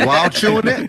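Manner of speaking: laughing. Real, not acted. Laughing at beatbox claim.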